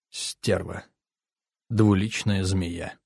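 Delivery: low, quiet, slow contempt; hushed, seething insult to the absent; bitter cursing. A narrator's reading. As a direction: curse under your breath. Alone, cursing her after she left.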